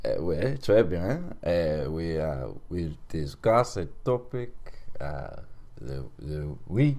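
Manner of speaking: halting mock-French accent; French-accented host, searching for words. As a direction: fumble along in a put-on French accent. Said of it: "End of accent".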